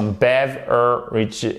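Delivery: "beverages" broken slowly into syllables; slow, separated syllables in "beverages"; syllable-by-syllable demonstration. Shown as a demonstration. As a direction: spell out "beverages" sound by sound, very slowly. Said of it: "mais attention".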